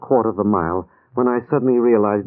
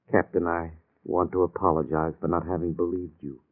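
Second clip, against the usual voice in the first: subdued apology; 6 semitones lower, 7 dB quieter than usual. Acted. Patient apologises to captain.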